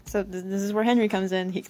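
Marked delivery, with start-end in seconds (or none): none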